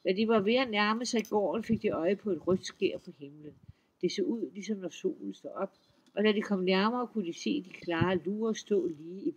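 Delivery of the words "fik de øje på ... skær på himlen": ordinary delivery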